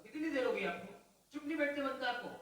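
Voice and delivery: loud, high, scolding a noisy listener; mock angry scolding. Preacher scolding a chatty listener, mock angry. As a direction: snap at them in mock anger.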